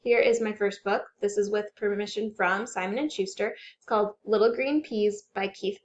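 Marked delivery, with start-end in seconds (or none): none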